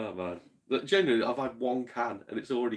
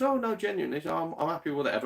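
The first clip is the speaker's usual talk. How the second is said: lightly voiced woman; 6 semitones higher, faster than usual. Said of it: "she goes".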